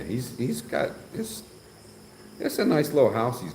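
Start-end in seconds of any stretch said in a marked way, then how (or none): 0.0-1.4 whisper. Real, not acted. Aside about the house.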